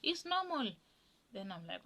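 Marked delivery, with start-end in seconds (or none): 0.0-0.7 voicing the mother. Mother voiced on the phone.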